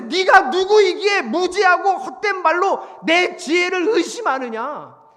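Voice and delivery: slow, loud, high-pitched booming demand; stretched-out, forceful accusation; thundering divine rebuke. Acted. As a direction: pronounce it like an outraged God from on high. God's rebuke repeated for effect.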